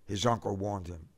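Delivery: plain reading voice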